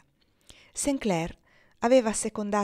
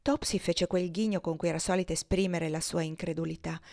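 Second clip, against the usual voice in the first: ordinary delivery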